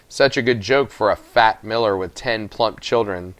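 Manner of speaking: ordinary delivery, nothing marked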